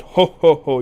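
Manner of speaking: loud, high put-on "ho ho"; hammed-up hearty English laugh; mock laugh. Englishman amused by the guest's joke, mock laughing. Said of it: "The Englishman laughed and said".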